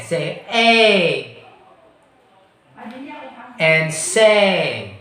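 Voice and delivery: "a" and "say" with a gliding ay; repeat prompt. Shown as a demonstration. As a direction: model for the class to repeat. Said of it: "Say it again".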